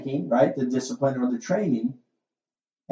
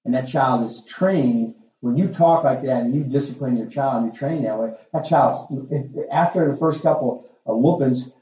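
usual speaking voice

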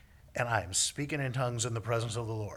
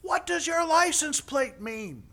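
high, loud, slow shouted question; imitating a rescuer's drawn-out yell; yelling paramedic; 13 semitones higher, 7 dB louder than usual. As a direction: holler a question from afar. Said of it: "he's still yelling as he comes".

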